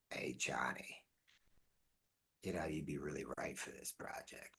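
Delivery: hushed whispered aside; breathy whisper, like a secret tip; imitating a whisper. Vin Diesel leaning in to tip Johnny off, whispering.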